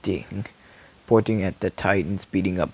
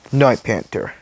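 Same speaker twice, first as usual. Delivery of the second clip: ordinary delivery, nothing marked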